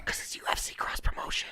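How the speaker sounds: hushed conspiratorial whisper. Real, not acted. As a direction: keep it secret, whisper. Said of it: "Why are you whispering?".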